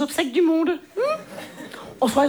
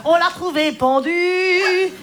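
slow hammed-up tearful lament; milk it like a melodrama; mock sob story, slow and heavy; mock tragic; 3 dB louder, slower than usual. Mock tragic tale, lines 1-10.

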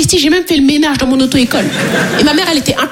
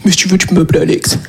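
smug guy; 8 semitones lower than usual. Retold or imitated. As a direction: lower your voice like a show-off guy. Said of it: "il a dit". (voix grave de frimeur)

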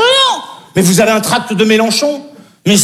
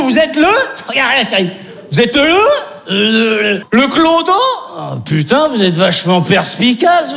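ordinary talk, nothing marked

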